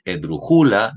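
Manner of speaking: "esdrujula" stressed es-dru-JÚ-la; deliberately misplaced penultimate stress on "esdrujula"; wrong stress on JÚ. Shown as a demonstration. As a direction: lean on the "ju" of "esdrujula". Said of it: "ni es esdrujula".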